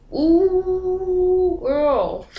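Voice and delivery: put-on high sung-out ooh, girl; teasing friends. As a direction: sing it like friends mocking you. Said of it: "looking at you like".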